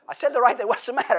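high, loud puzzled protest; baffled defence. Retold or imitated. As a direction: act confused about what went wrong. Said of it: "It's the tone".

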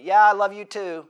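loud, dismissive reply; wrong tone. Retold or imitated. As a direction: toss the words off with a sour tone. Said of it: "It's the tone".

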